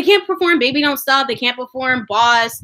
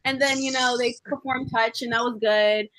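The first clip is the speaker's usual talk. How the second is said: normal talking voice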